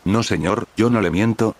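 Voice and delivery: usual speaking voice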